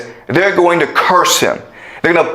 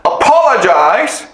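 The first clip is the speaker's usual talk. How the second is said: higher, pushier bully voice, apologize stretched; angry bully threat; 9 semitones higher than usual. Retold or imitated. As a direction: threaten the new kid, a hammed-up bully. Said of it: "the bully goes in front of the new kid and says".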